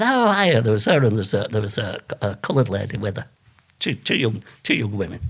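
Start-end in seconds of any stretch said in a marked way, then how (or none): 0.0-0.6 acted greeting. Retold greeting from office woman.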